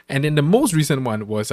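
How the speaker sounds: ordinary talk, nothing marked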